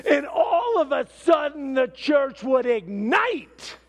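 high, loud burst of excitement; excited build. Real, not acted. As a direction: punch it out as a sudden eruption. Church suddenly bursts into worship.

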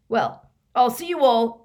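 lightly voiced shifty thief; hasty shifty farewell. A narrator's reading. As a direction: bid a blustering goodbye to escape. Thief hurries to get away.

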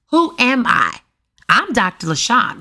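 ordinary talk

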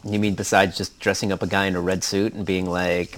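usual speaking voice

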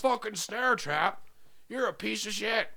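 hammed-up angry dad; berating dad. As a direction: play a contemptuous father tearing into his kid. Acted dad berating his son.

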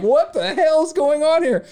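baffled inner outburst; disbelief. Retold or imitated. Host's inner thought as the cop talks rap: high, bewildered disbelief.

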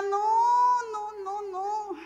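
high, drawn-out wail fading away; hammed-up dread; mock dismay. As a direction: wail it in exaggerated reluctance, then let it die down. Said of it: "Y si lloraba menos, decía".

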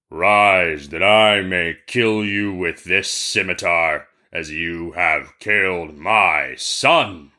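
slow, menacing genie; a huge old genie pronouncing doom, drawn out; terrible genie voice. Acted. Genie confronts merchant over his son, terrible slow voice. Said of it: "spoke to him in a terrible voice".

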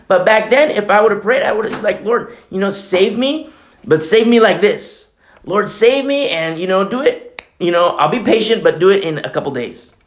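high, pushy pleading to the Lord; half-mocking his old impatient prayers. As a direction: demand rescue on your own terms.

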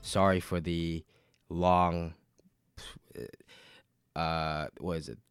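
ordinary delivery

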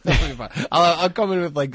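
loud, laughing brush-off. Real, not acted. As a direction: laugh it off with force. Repeats it laughing, louder.

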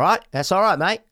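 cheery Australian mate; Australian accent on request. Pretend. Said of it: "Do it".

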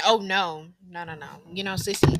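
usual speaking voice

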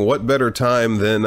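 normal talking voice

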